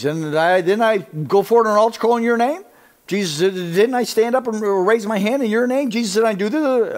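high, loud protesting believer; hammed-up, insistent appeal; mock frantic pleading. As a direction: plead your case urgently, stumbling over repeats. Acts churchgoers pleading their deeds.

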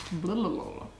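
normal talking voice

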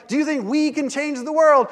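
high, loud call; rallying question. Retold or imitated. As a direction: work the crowd up. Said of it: "And I'm like".

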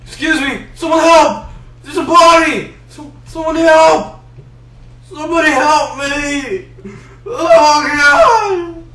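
high, loud, hammed-up alarm; mock cry for help. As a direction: call out like a fake witness. Pretending to find a body.

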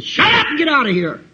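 high, heated outburst; acted angry yell; angry rebuff. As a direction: order the visitor out furiously. Condemned boy rebuffs the governor.

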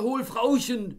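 very high, loud order; frantic shout. Acted. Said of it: "fürchterliche Drohungen herabstieß".

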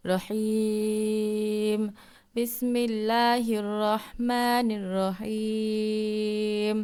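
drawn-out tilawat of the verse; slow recitation. Shown as a demonstration. Teacher reciting the full verse as a model, slowly. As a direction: chant it slowly, holding the long vowels. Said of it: "তিন আলিফ টান দিতে হবে".

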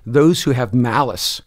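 usual speaking voice

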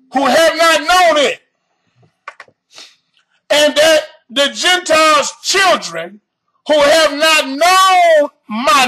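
building shouted preaching, hammering known; preaching at full force. Acted. Escalating preaching of the verse.

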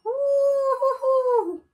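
high, slow drawn-out cheer; whoop. Real, not acted. Gamer cheering her characters, high stretched whoop. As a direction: sing out the victory whoop. Clearing a jump.

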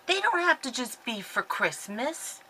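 ordinary delivery

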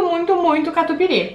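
muffled behind hand; talking while chewing. Real, not acted. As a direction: mouth full of burger. Seen 1: Hand covers mouth while chewing after bite, holding burger throughout.

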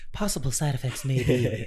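TV ad announcer voice; commercial voice. Shown as a demonstration. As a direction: read it like a drug ad voiceover. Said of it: "can you say it in the commercial voice".

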